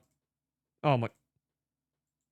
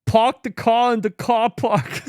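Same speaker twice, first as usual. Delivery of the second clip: put-on loud, high, slow Boston accent; exaggerated Boston drawl; mock Boston accent; 6 semitones higher, 7 dB louder, slower than usual. Joking about Boston pronunciation.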